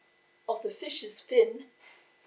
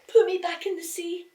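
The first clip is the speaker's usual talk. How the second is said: higher, louder pleading little fish; crying fish; 8 semitones higher, 7 dB louder, faster than usual. A narrator's reading. Fish begging the boy, high pleading cry. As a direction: cry out to be put back. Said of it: "the fish cried".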